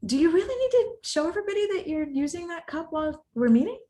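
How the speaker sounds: high, doubting, sarcastic question. Shown as a demonstration. Asking Mark about his cup, questioning tone. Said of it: "different ways that you can say things".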